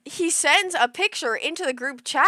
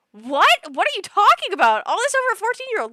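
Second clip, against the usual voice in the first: incredulous; 9 semitones higher, 7 dB louder, faster than usual. Retold or imitated. Retold disbelief at his stunt.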